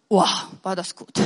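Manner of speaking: a hint of God admiring his work, low and soft; God delighted. God looking at his finished world, quietly pleased. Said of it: "hat er gesagt".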